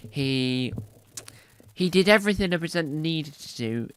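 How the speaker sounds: ordinary talk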